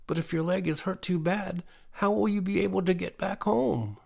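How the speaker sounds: a hint of a worried child asking; mildly voiced young girl, puzzled; questioning little girl. A narrator's reading. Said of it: "and then said".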